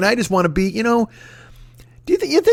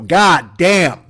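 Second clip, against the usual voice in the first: God damn drawn out and louder, a mock grand finale; final big goddamn; 4 dB louder than usual. Shown as a demonstration. Comedian announces his last goddamn of the show, louder and drawn out. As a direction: savor the last curse out loud. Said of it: "I'm gonna say goddamn one more time".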